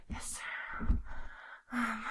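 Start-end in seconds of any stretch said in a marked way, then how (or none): 0.1-0.9 soft breathy whisper. Real, not acted. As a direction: repeat it in a whisper. Repeat in whisper.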